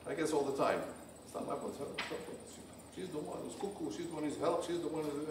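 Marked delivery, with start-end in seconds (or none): 1.2-5.3 muttered blame. Retold or imitated. Imitating a blaming partner.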